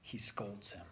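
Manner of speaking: soft near-whisper; hushed aside. Real, not acted. Preacher confiding a hard truth, softly. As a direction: lean in and confide it. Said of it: "I'm gonna say this".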